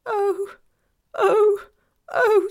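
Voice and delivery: high loud sobbing wails; sobbing cries. A narrator's reading. Weeping faun wailing to Lucy, loud sobs. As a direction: howl out your grief. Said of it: "sobbed Mister Tumnus".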